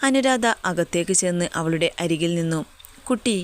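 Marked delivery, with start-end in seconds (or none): 3.1-3.4 put-on a short, serious call to the girl; stern call. A narrator's reading. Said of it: "ഗൗരവത്തിലുള്ള വിളി കേട്ട്". (ഗൗരവത്തിലുള്ള വിളി)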